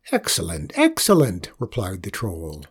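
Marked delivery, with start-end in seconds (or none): 0.1-0.7 "Excellent" said low and falling, a pleased murmur. A narrator's reading. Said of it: "nearly jumped out of his skin with joy". 0.7-1.5 "excellent" much higher and louder, bursting with joy; joyful exclamation. A narrator's reading. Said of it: "nearly jumped out of his skin with joy".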